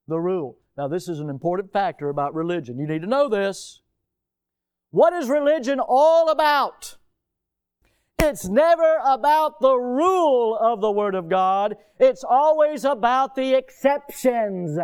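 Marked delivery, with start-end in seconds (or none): 4.9-14.8 high, loud, ringing declaration; preaching at full force. Real, not acted. Preacher denouncing religion's love of exceptions, loud and high. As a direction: proclaim it to the back row.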